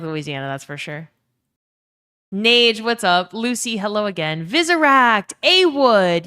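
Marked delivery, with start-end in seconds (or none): 2.3-6.3 loud, high name call; excited shout-out. Real, not acted. Streamer greets returning viewers in chat, excited shout-out. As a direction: hail them loudly, pitched up. Calling out arriving viewers' names.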